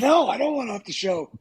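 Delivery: high, protesting "no" that falls away; emphatic refusal. Retold or imitated. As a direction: push back emphatically. Acted emphatic refusal to his boss.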